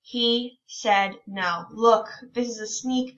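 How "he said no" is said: slow reading. Shown as a demonstration. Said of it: "reading aloud to children".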